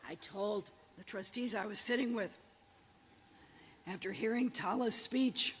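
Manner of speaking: ordinary delivery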